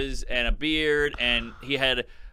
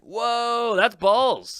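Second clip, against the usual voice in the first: impressed whoa; 8 semitones higher, much slower than usual. Real, not acted.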